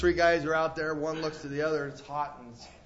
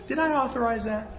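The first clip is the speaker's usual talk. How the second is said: normal talking voice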